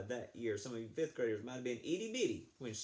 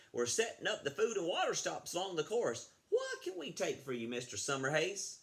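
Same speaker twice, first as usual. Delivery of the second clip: louder, higher official; 6 semitones higher, 3 dB louder than usual. Acted. The race official asks Josh about food stops, high and loud. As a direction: ask it up high and brisk.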